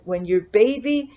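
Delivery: ordinary talk, nothing marked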